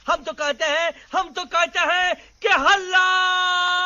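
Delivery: rallying cry. Acted. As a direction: build to a long shouted war cry. Leader rallies his monkey army. (जोश भरी लंबी ललकार)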